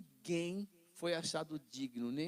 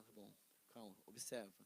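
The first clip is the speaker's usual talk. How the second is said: much softer, calm voice of God; gentle reassurance; 8 semitones lower, 17 dB quieter than usual. Retold or imitated. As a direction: soothe them like a patient father. Deus acalma a pessoa. (voz suave e tranquilizadora)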